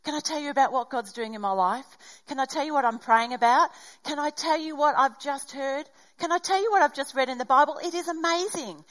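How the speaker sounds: eager testimony, words tumbling out; bubbling over. Retold or imitated. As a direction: gush like someone who can't wait to share. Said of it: "have you lost that bubbling over".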